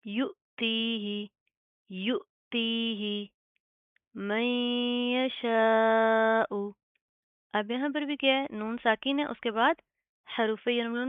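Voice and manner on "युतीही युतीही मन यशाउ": slow, chanted tajweed recitation; slow melodic Quran reading; Quranic recitation. Shown as a demonstration. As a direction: draw each word out in a measured chant.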